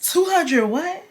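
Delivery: slow, high, rising question; stunned, high-pitched double take; incredulous. Acted. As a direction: draw out the question in disbelief. Incredulous reaction to money request.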